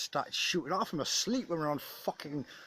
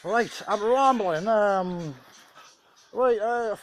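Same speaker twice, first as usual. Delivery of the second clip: loud self-interruption; 9 semitones higher, 9 dB louder, much slower than usual. Real, not acted. Catches himself rambling.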